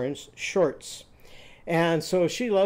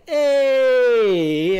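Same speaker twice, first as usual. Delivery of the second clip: loud drawn-out vowel; 8 semitones higher, 9 dB louder than usual. Shown as a demonstration. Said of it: "vowel review".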